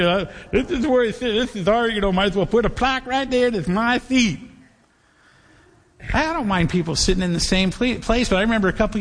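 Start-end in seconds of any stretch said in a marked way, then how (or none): 0.0-4.4 possessive pew-sitter. Acted. Acting out a territorial pew-sitter.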